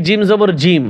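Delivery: জিম repeated whole in place of যা; wrong spelling-out. Shown as a demonstration. Teacher quoting how many misread it, ending on whole জিম. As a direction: run it on and end on জিম. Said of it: "অনেকে পড়ে কি".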